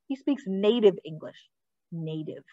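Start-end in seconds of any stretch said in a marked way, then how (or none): 1.9-2.4 barely voiced, much softer; mouthed, near silent. A narrator's reading. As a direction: shape the word almost silently to yourself. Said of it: "I mouthed the word".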